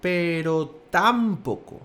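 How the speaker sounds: "tampoco" slow, with heavy weight on TAM; drawn-out "tampoco", stressed TAM-poco; shifted stress. Shown as a demonstration. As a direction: punch the first syllable of "tampoco". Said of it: "la fuerza la está poniendo".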